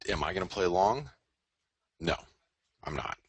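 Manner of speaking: ordinary talk, nothing marked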